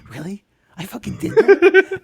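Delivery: high, loud mock astonishment; laughing surprise. Brother hearing what he said asleep, high amused disbelief. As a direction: imitate amused disbelief, voice leaping way up. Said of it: "very surprised, but still laughing".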